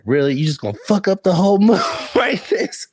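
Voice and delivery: high, indignant retold question, laugh breaking in; mock upset. Woman upset at her friend for spoiling the mood, mock upset. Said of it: "she got so upset at the friend".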